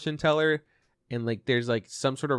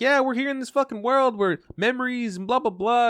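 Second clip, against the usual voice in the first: high, lightly put-on cat voice, a bit louder; imitating kitty voice; 11 semitones higher, 3 dB louder than usual. Retelling the stuffed animal's scene, mock kitty voice. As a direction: squeak it out like a cartoon cat. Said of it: "a kitty voice".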